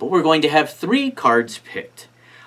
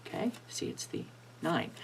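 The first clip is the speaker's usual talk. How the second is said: whispered aside; 3 semitones higher, 19 dB quieter than usual. Shown as a demonstration. Secret aside while peeking.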